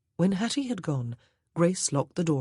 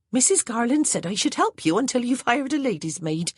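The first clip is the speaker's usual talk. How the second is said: higher, louder servant voice with a country lilt; a plain-spoken old housemaid reporting orders; Hattie the maid's voice; 8 semitones higher, 4 dB louder than usual. A narrator's reading. Maid Hattie to her new mistress, higher and louder.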